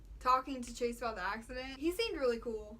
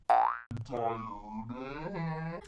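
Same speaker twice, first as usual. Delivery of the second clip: low, groaning tired voice; 12 semitones lower, 4 dB louder than usual. Retold or imitated. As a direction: drop low and sound worn out. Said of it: "Amanda's like".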